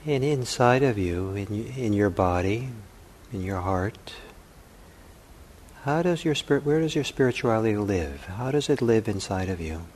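unhurried meditation voice with long pauses; calm contemplative guiding, phrase by phrase; guided meditation. Acted. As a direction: softly offer questions, leaving silence between them.

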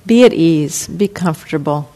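ordinary talk

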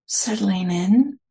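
unhurried meditation guidance with long pauses; guided meditation. Real, not acted. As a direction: gently guide listeners inward, leaving space between phrases.

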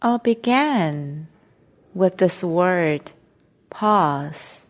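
slow meditation guidance with long silences between phrases; guided meditation. Real, not acted. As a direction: ease them into stillness, phrase by phrase, unhurried.

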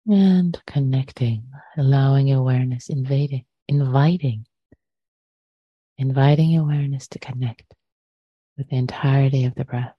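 measured meditation guidance with long pauses; short phrases, unhurried, letting silence settle between them; guided meditation. Acted. Teacher guiding listeners to arrive in body and breath, guided meditation.

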